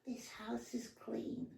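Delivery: high, slow, breathy little Poltergeist medium impression; badly faked Poltergeist medium; terrible Poltergeist medium. Jokingly doing a Poltergeist line for the podcast host, high and slow. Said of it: "With my terrible impression".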